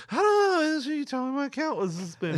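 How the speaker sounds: very high, loud squeaky parody of himself; mocking his own voice. Caller mocks his own flustered reply, squeaky high. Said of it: "you were in my".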